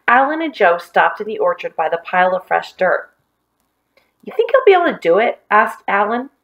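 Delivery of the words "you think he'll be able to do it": eager. Acted. Excited boy asks friend.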